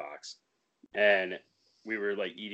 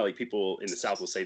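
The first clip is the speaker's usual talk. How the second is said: usual speaking voice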